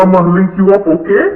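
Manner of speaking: ordinary delivery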